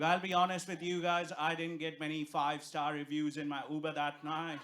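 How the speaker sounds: ordinary talk, nothing marked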